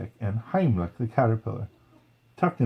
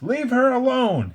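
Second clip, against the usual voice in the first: high, loud, urgent demand; 14 semitones higher, 4 dB louder than usual. A narrator's reading. Flick demanding the grasshopper leave Dot alone. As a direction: stand up to the bully, loud and high. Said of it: "he demanded".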